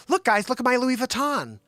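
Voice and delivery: high-pitched mock bragging; mock show-off. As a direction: imitate an excited bag show-off. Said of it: "you go out there and you do".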